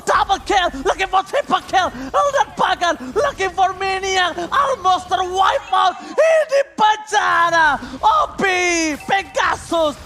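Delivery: hyped esports caster shouting; fighting game announcer. Real, not acted. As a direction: yell the teamfight play-by-play. Teamfight climax, caster hype. (teriakan komentator yang heboh)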